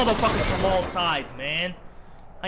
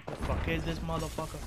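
ordinary talk, nothing marked

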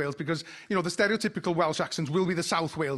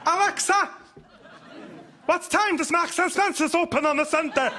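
North Welsh wife; 10 semitones higher than usual. Retold or imitated. Comic plays his wife asking Alexa in Welsh accent. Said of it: "wife trying to converse with Alexa".

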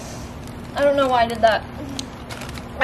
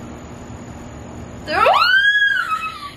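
nervous laugh. Real, not acted. Nervous laugh before eating.

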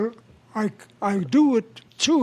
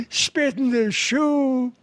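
slow lament, rising high at the end; 4 semitones higher, slower than usual. Acted. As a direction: draw it out like a long-suffering complaint.

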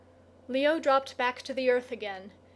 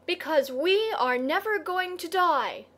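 high, raised-voice proclamation; moderately loud, higher emphatic cry; loud declaration; 5 semitones higher, 3 dB louder than usual. A narrator's reading. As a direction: declare it out on a lifted pitch. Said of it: "still more loudly".

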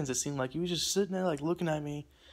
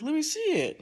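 much higher voice imitating his request; imitated stranger; 13 semitones higher, 3 dB louder than usual. Stranger asking to see the phone, imitated high request. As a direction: mimic the stranger, pitched way up.

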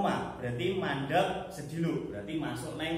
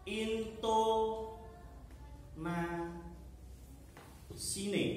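very slow, drawn-out syllables; dictating; 3 semitones higher, 8 dB quieter, much slower than usual. Shown as a demonstration. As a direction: spell it out syllable by syllable for writers. Dictates the second point.

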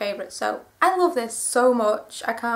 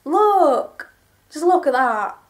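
excited exclamation; 4 semitones higher, 6 dB louder, slower than usual. Real, not acted. Excited showing off a t-shirt.

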